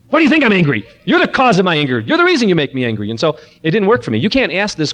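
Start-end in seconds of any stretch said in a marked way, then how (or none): 0.1-3.1 loud, high angry retort; retold son yelling at his father. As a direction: snap back at the parent, louder. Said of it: "son's angry retort, retold".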